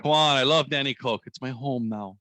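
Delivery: ordinary talk, nothing marked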